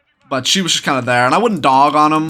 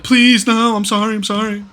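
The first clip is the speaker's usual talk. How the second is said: high-pitched mock pleading; retold groveling; 8 semitones higher than usual. Storyteller begging a bigger man for mercy, groveling up high. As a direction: beg for mercy in a raised voice, fading off. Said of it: "I'm pretty much groveling to this man".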